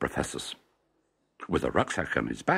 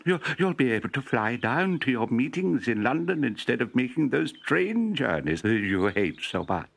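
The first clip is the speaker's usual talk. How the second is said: lightly voiced professor; 7 semitones higher than usual. Professor voiced, coaxing wife.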